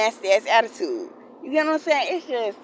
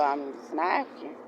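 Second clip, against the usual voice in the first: quiet self-talk; 6 semitones lower, 7 dB quieter than usual. Retold or imitated. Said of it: "I just kept telling myself".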